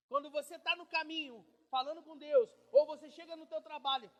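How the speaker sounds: loud, driving sermon build-up; fiery preaching. Acted. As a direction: preach it to the crowd. Preaching at full force.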